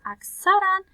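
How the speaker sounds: اکثراً jumps high and loud; emphatic. Shown as a demonstration. Teacher stressing to students that the rule holds mostly. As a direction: land hard on اکثراً.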